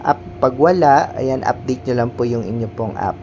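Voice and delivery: ordinary talk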